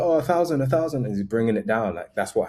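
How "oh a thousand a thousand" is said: higher, louder mock haggling call; quoted vendor, pitched up and insistent; imitating eager vendor. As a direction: play a seller calling a customer back. Vendor calling after departing customer.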